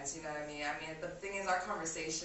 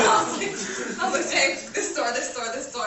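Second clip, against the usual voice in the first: excited; 5 semitones higher, 14 dB louder than usual. Retold or imitated. Excited shopping, laughing loudly.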